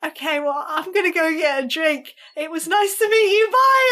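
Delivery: rising to a high, loud bye; flustered goodbye. Retold or imitated. Fan flees her idol for a drink, flustered.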